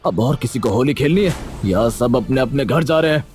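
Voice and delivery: mildly angry headman; angry, forceful. Acted. Headman threatens villagers playing Holi, angry and forceful. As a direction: order everyone to stop with some force. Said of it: "गुस्सा होकर जोर से बोले".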